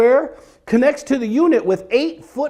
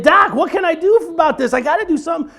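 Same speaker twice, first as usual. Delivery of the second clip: higher mock-pleading patient; pleading with doctor; 7 semitones higher, faster than usual. Patient begs his doctor for a fix, mock pleading. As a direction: beg the doc, half whining. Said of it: "I was like".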